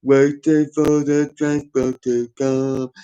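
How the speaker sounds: slow, dopey mock delivery. Ridiculing [name]'s spoken lyric, very slow dopey voice. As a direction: drag the words out like a dimwit.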